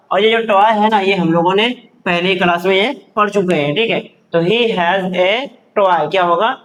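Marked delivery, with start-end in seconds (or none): 4.4-6.1 very slow, word by word; drawn-out words, evenly spaced; slow reading drill. Shown as a demonstration. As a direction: model the sentence for beginners, slowly. Teacher models sentence to learners.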